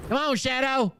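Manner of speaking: high coaxing falsetto; mock pet calling. Heckler pretending to call Shadow out of hiding, mock coaxing.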